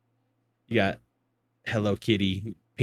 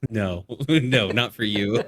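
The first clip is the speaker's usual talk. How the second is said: normal talking voice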